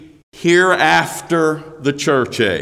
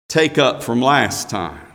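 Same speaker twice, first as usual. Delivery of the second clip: ordinary talk, nothing marked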